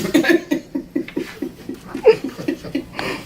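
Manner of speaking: a light, airy giggle up high; high breathy laugh. Real, not acted. Reader giggling at the child's excuse, a high breathy laugh.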